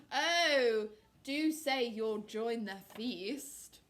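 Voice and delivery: slow, drawn-out sly invitation; loud opening oh, then oily and slow; coaxing fox. Acted. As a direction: coax them with false politeness. Fox coaxes his prey.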